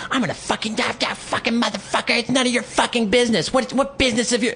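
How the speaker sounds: high-pitched mock screaming rant; imitating an unhinged screamer. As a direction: mimic him losing it, voice shot way up. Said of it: "loses it ... screaming in my face".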